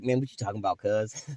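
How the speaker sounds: low, soft, playing dumb; guilty denial. Guilty man brushing off the accusation, low mumble. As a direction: deny it quietly, low and cagey.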